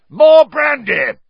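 loud high yell; Uncle Vernon, yelling. A narrator's reading. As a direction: yell to drown the row. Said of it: "yelled Uncle Vernon".

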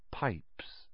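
breathy unvoiced whisper, very soft; a single word whispered under the breath. A narrator's reading. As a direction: hush it like a secret clue. Hermione's note read in a whisper.

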